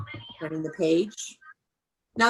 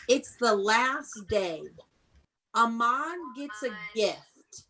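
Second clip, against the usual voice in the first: slow reread; slower than usual. Shown as a demonstration. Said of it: "you gotta listen".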